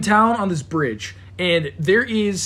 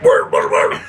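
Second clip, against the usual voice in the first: mock whimper; 6 dB louder than usual. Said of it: "it's like".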